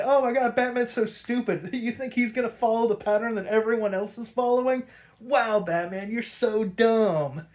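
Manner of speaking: high, incredulous jeering; mock him like a scornful crowd; high-pitched mock disbelief. Crowd mocking Batman in retold scene.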